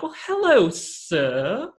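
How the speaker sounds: high, prim "well, hello, sir"; formal greeting. Shown as a demonstration. Greeting Erin's dog Paddy formally, prim and high. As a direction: greet the dog like a distinguished gentleman. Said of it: "I talk to them so formally".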